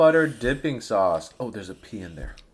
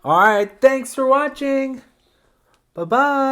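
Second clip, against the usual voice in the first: high, loud bright goodbye; cheerful sign-off; 10 semitones higher, 7 dB louder than usual. Real, not acted. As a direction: sing out the outro.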